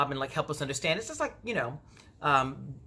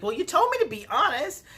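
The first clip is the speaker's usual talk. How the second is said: high, loud defensive imitation; mock defensive; 11 semitones higher, 3 dB louder than usual. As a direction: toss back the excuse. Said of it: "she's like".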